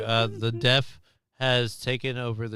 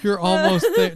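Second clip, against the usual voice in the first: louder, laughing through it; laughing quote; 8 semitones higher, 6 dB louder than usual. Real, not acted. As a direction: cry it out between laughs. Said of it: "do not say".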